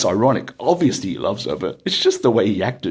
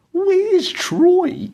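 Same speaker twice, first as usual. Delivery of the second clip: put-on high, loud old-lady voice, suddenly worried; a mother asking for her son, voiced high and loud; anxious elderly auntie; 5 dB louder than usual. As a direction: pitch it way up and call for her son. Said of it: "And then she goes".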